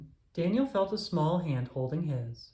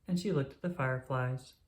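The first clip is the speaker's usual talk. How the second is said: usual speaking voice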